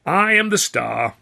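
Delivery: high, loud, slow sneering imitation; mocking Chaplin. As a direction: ape his grand claim.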